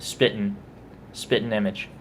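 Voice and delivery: "Spitting" reduced to spit'n; dumbed down. Shown as a demonstration. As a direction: drop the G and vowel in "Spitting". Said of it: "dumb it down a bit".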